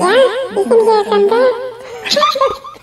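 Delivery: put-on squeaky high baby-voice giggle; baby voice laugh. Shown as a demonstration. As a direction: laugh like a tiny baby through the mic. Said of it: "Say it".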